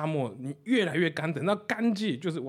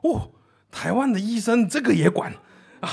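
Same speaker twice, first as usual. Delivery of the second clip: higher and louder, amazed foreigner; surprised foreigner voice, higher and louder; 7 semitones higher, 7 dB louder than usual. Retold or imitated. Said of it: "这个德国人很惊讶".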